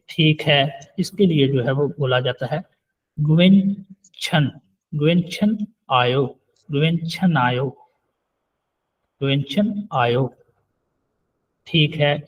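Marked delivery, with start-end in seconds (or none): 3.2-10.3 slow, careful गुवेन छन आयो; slow teaching model. Shown as a demonstration. Teacher modelling a Korean phrase slowly for students. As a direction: spell it out slowly for learners.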